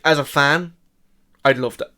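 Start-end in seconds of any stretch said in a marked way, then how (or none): 1.4-1.9 talking with hiccups; hiccuping between words. Real, not acted. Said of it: "I have the hiccups".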